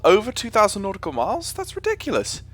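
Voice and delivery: squeaky-high, astonished disbelief; incredulous. Real, not acted. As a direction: voice shoots right up in amazement. That's ridiculous.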